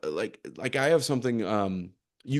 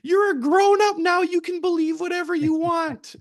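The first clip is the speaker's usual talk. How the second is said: put-on high, louder old grandma voice; grandma voice pitched way up and a bit louder; imitating grandma; 5 dB louder than usual. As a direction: put on a much higher voice to play grandma cheering him on. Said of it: "And she said".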